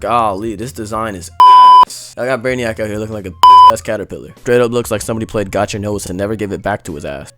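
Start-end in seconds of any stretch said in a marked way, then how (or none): 0.0-2.1 loud, slow exasperated outburst; loud rant. Real, not acted. Ranting about the design. 2.2-5.8 loud fast rant. Real, not acted. Ranting about the design.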